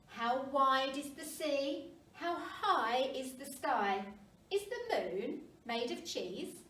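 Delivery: plain reading voice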